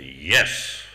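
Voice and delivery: low, stern imitation of the guru; imitated stern, low-pitched authority; really stern. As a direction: say it deep and severe, as a mock reprimand. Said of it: "he went really stern".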